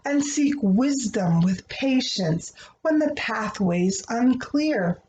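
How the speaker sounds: gentle, rhythmic picture-book reading; reading to children. Acted. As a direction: read the rhyming prayer like a bedtime story. Reads a rhyming prayer book aloud.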